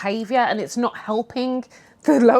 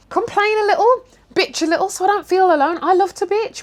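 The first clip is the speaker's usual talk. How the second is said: much higher, louder, teasing sing-song; playful squeal; 10 semitones higher, 4 dB louder than usual. Acted. Wishing coworkers would gripe with her, playful high. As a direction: coax them into griping with you.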